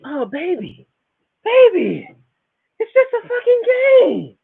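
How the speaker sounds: high, sing-song girlfriend impression, soothing baby; mock girlfriend voice. Girlfriend calming her gamer man, mock high voice. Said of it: "your lady was like".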